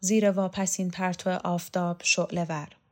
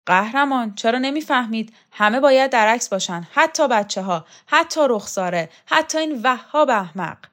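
louder, insistent scolding with a higher pitch; exasperated scolding; 4 semitones higher, 8 dB louder than usual. Acted. Stamping foot at a stubborn old hero, loud exasperated scolding. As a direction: scold him for not understanding.

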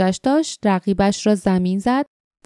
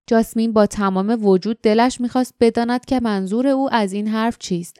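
usual speaking voice

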